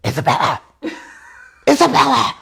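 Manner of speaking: hushed yell calling the dog; mom whisper yell. Retold or imitated. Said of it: "That's the, that's the mom whisper yell".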